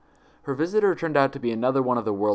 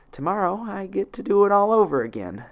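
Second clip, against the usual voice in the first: breaking despair; 11 semitones higher, 4 dB louder than usual. Acted. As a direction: voice jumps much higher and louder, near tears. Twilight's despairing breakdown monologue.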